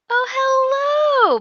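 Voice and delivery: sweet voice. Acted. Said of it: "putting on this sweet voice".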